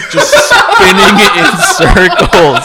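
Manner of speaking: slow, loud and breaking into laughter; can barely get it out for laughing. Real, not acted. Friend retelling the spinning drunk, helplessly laughing out loud.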